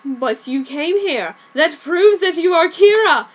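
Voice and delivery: slow start rising into a high loud accusation; detective springing his trap on the killer; accusing. A narrator's reading. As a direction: build to a triumphant accusation. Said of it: "L decried".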